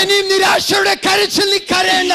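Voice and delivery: fervent interpreted preaching. Acted. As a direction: echo the preacher's fire. Interpreter matches preacher's fervor. (ആവേശത്തോടെ ഉച്ചത്തിലുള്ള പ്രസംഗ വിവർത്തനം)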